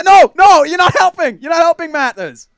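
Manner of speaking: high, loud exasperated protest. Real, not acted. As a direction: cry out in mounting frustration. Exasperated at teammate's muddled answer.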